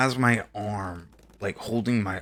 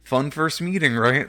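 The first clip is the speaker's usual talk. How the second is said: put-on teasing girlfriend; 6 semitones higher, 8 dB louder than usual. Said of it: "she's like".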